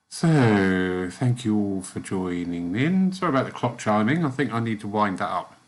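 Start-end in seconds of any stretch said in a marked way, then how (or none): none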